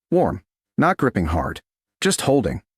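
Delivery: plain reading voice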